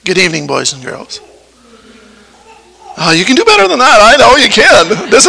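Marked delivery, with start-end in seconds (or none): 0.0-1.2 put-on a quiet, low greeting to the children; soft greeting. Shown as a demonstration. Leading the children's greeting, soft and low. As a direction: greet them softly and invite them to answer. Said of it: "let's try this again".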